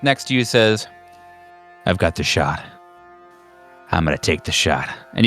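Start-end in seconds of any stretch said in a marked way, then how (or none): 1.9-4.9 low, hungry sniper murmur; jonesing for the kill, held low; lustful eagerness. Acted. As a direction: say it low, savoring it with relish. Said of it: "you almost hear the sound of, like, lust".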